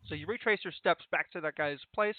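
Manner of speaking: ordinary delivery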